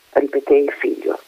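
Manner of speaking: ordinary delivery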